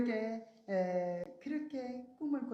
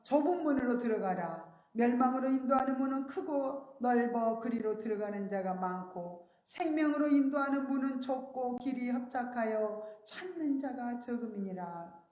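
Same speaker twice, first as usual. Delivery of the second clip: raised, louder solemn reading of the verse; scripture reading; 3 semitones higher, 5 dB louder than usual. Acted. Preacher reading the Bible verse to the church, solemn proclamation.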